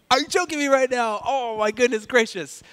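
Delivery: high, amazed disbelief; playful stunned viewer; incredulous. Retold or imitated. Mock disbelief at the show.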